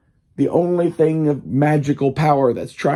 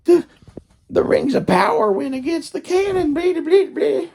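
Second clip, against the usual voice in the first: high mocking whine, much higher pitch; mocking whiny fans. Speaker mocks fans complaining about canon, high mocking whine. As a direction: parody the canon complainers in a high nasal sneer. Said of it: "all of these people are like".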